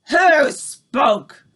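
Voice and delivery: loud, sharp witch shriek; Grand High Witch shrieking at the silent room. A narrator's reading. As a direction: shriek the question in a rage. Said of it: "she shrieked".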